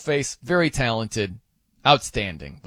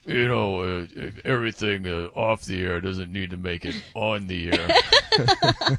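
lower, slow, put-on Coop voice; Coop impression; 7 semitones lower than usual. Host does his Coop impression for Lorena, low and slow. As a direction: lay on Coop's voice low and drawn out. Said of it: "let me do my Coop impression".

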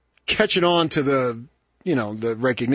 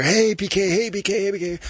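higher-pitched imitation of fans calling out; excited fans; 8 semitones higher than usual. Fans spot their radio host, calling out in imitation.

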